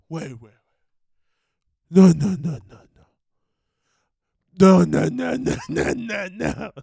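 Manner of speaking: lightly voiced whiny Sandler-style denial; a hint of Adam Sandler, sputtering no's; Adam Sandler impression. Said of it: "I'm going full Adam Sandler".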